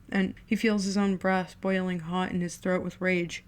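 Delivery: plain reading voice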